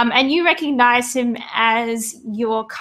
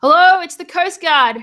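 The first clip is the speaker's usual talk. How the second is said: raised, higher calling voice; yelled hail; 7 semitones higher, 4 dB louder than usual. Acted. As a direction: hail them like a coast guard in the rain. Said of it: "yell out".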